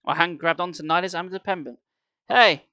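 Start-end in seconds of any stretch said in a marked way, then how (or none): 2.3-2.7 sharp, loud, higher cry. A narrator's reading. Boy crying out as the mummy tosses him, loud cry. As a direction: yelp as you're thrown. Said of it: "I cried".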